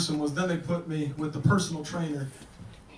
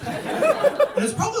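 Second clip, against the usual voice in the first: laughing squeal; 4 dB louder than usual. Real, not acted.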